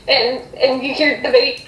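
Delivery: ordinary delivery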